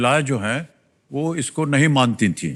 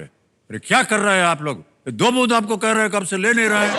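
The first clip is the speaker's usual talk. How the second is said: higher-pitched mock telling-off; re-enacted scolding; 7 semitones higher than usual. Host re-enacting his polio ad for villagers, scolding. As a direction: scold them in an exasperated, raised pitch, as a re-enactment. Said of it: "उसमें हम बहुत डांट के बोले".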